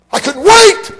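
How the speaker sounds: high, loud burst of eagerness; preacher shouting his eagerness to the crowd; sudden shout. Acted. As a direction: blast it out with excitement. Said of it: "I couldn't wait to get there".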